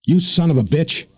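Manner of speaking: high furious outburst; hopping mad. Acted. Woman back on the pontoon cursing the mariner, high and furious. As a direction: spit it at him, livid. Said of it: "hopping mad".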